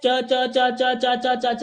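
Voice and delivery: "च" repeated crisply, each one separate; repeated ch sound. Shown as a demonstration. Teacher shows students the repeated च sound. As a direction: tap out च, च, च for the class. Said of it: "repeat हो रहा है".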